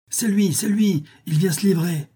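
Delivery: high-pitched excited cries; crowd crying out. A narrator's reading. Crowd spotting the suspect, crying out. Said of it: "des voix crièrent".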